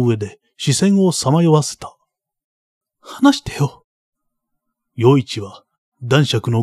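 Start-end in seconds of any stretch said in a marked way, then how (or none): 3.0-3.9 put-on pleading boy. Boy begs to be told.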